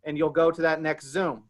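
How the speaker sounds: ordinary talk, nothing marked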